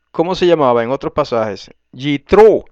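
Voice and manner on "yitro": Yitro stressed loudly on TRO; Hebrew pronunciation. Shown as a demonstration. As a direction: punch the last syllable of Yitro. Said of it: "en hebreo se dice Yitro".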